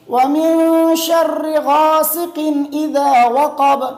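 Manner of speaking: "شر" as "sharri", slowly chanted; correct tajweed. Shown as a demonstration. Teacher models the next verse, correct tajweed. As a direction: recite "ومن شر غاسق" slowly with long vowels. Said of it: "آگے".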